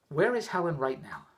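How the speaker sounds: soft, slow and calm. Retold or imitated. Husband calmly asking his wife on the phone. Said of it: "very calmly".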